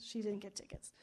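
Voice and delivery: whispered aside, no voice at all; a hushed, voiceless punchline. Acted. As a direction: breathe it out like a secret. Punchline contrast to his claim.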